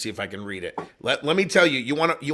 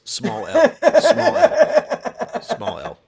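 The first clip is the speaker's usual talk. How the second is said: high, laughing, much louder "small L"; laughing correction; 8 dB louder, much slower than usual. Real, not acted. Laughing to the co-host about the title, high and loud. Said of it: "is the better response".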